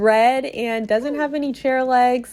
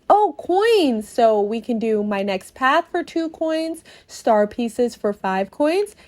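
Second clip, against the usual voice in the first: ordinary talk, nothing marked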